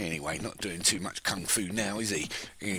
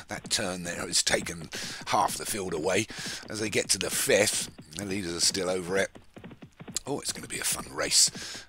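ordinary talk, nothing marked

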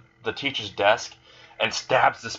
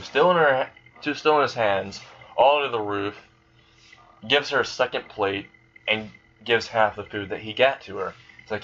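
plain reading voice

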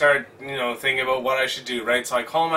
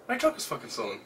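muttered thought; 4 semitones lower, 10 dB quieter, faster than usual. Retold or imitated. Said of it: "think to myself".

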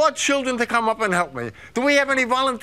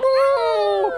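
wolf howl; 13 semitones higher than usual. Pretend. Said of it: "every time I say lamb".

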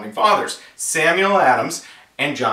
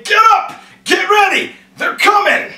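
very high, loud mock-frantic shout; yelling and screaming; 6 dB louder than usual. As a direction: scream them out of bed. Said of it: "he was just yelling and screaming".

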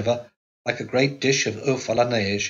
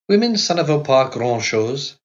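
louder, dismissive French; French nurse; 5 semitones higher, 5 dB louder than usual. A narrator's reading. Nurse dismissing women in French, louder and scornful.

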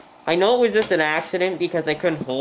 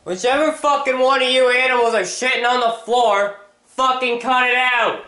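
much higher and louder, yelling at the dogs; furious yell; 11 semitones higher, 4 dB louder than usual. Real, not acted. Owner yelling at his dogs for fouling the floor, furious shout. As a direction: bellow it at the animals who made the mess.

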